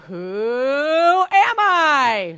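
slow, shouted, drawn-out title call; game show announcer. Shown as a demonstration. Host shouting the title question for the group to answer. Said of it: "I worked on different emphasis".

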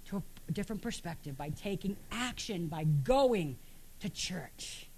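normal talking voice

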